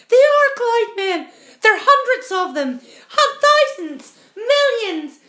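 high, very loud alarm; panicked shouting. A narrator's reading. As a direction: shriek each bigger number in panic. Terrified discovery of cloud men.

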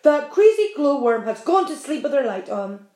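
loud, cross centipede voice; grumpy centipede. A narrator's reading. Centipede complaining to James about the glowworm. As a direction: grumble it out, irritated.

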